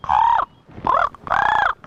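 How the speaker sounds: three loud, high caws; excited crow cawing. Retold or imitated. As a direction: caw like a worked-up crow. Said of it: "Now he was all excited".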